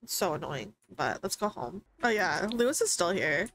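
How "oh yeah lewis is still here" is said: usual speaking voice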